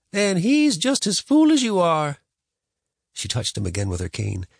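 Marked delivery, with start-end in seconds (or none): 0.1-2.2 higher, slow, louder scold; scolding grandma. A narrator's reading. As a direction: snap like a cranky granny.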